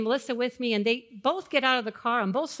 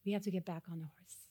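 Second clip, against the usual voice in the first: gentle coaxing; 4 semitones lower, 10 dB quieter, faster than usual. Retold or imitated. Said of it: "he says".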